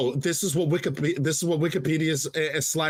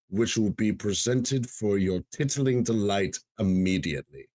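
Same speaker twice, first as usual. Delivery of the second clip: Alfred Hitchcock; 3 semitones lower than usual. Acted. Host recites a favorite quote to co-host, Hitchcock impression. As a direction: intone it formally with deliberate pauses, sinking at phrase ends. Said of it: "I might butcher my impression".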